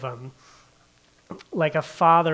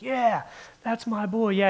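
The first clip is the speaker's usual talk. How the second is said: higher, excited mock cheer of "Yeah, that's my boy"; mock cheering dad; 6 semitones higher than usual. Proud father cheering his son at bat, mock excited yell. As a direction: play the proud dad cheering from the stands, lightly. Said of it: "screaming as loud as he can".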